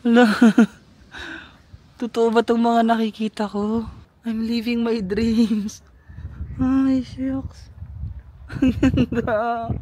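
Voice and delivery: high, slow gasps of wonder; awestruck. Real, not acted. Vlogger awed by the village view, slow and high. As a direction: marvel at the view out loud.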